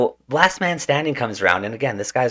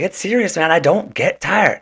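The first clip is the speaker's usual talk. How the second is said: earnest coach; 7 semitones higher, 3 dB louder than usual. Retold or imitated. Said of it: "He's like".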